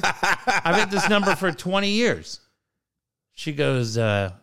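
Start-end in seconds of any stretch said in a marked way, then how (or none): 0.0-2.4 high, drawn-out mock outrage; exasperated retort, acted out for laughs; retold irate yell. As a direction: re-enact being rude to a caller, pitched up and slowed. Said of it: "no reason for me to yell at you".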